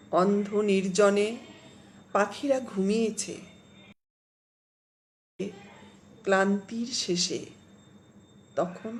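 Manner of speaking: slow, dramatic poetry reading; poem recitation. Acted. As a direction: swell on the stormy lines, hush at the end. Reads Chandrima's poem aloud.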